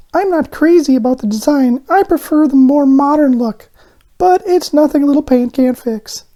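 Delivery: very high, eager little donut voice. A narrator's reading. Arnie the donut on the tray, excited and high. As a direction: squeak it out with childlike excitement.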